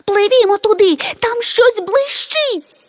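very high-pitched squealing yell; excited shrieking. A narrator's reading. Tiny creature spots something shining at sea, shrieking. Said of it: "тремтячи від збудження, зарепетувало".

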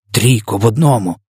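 ordinary delivery

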